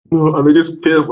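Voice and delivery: normal talking voice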